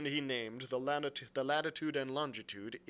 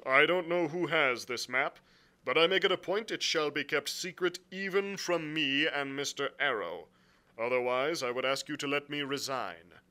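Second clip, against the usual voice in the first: louder, firm captain's voice; blunt sea captain; 5 semitones higher, 8 dB louder than usual. Acted. Captain explaining his orders to the gentlemen, bluntly. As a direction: state the grievance firmly and formally. Captain's dialogue beside narration.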